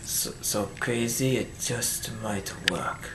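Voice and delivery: dramatic retry. Shown as a demonstration. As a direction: lean in, drag it out low and soft. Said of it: "I'm gonna try again".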